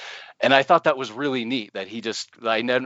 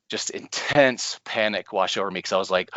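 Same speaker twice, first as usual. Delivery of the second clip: ordinary delivery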